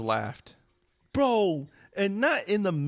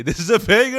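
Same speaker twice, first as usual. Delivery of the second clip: mock hype; 10 semitones higher, 10 dB louder, faster than usual. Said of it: "dead ass say".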